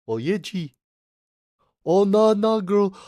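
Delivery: put-on Kiwi accent. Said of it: "Can you try Kiwi accent?".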